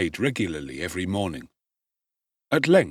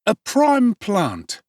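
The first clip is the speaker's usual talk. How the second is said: higher, louder and slow, relishing it; drawn-out gleeful pickpocket boy; Charlie Bates voice; 12 semitones higher, 5 dB louder, much slower than usual. A narrator's reading. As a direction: savour the words like a young thief spotting a prize. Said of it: "observed Master Charlie Bates".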